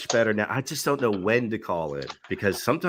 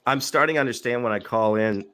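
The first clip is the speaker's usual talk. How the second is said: ordinary talk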